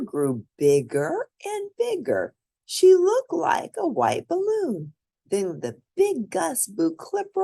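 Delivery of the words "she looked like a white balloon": ordinary delivery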